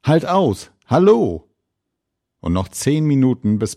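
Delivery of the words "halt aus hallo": hoher, lauter Jubelruf; jubelnd. A narrator's reading. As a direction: jubelnd anfeuern. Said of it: "das Schiffsvolk jubelt". (jubelnder Zuruf)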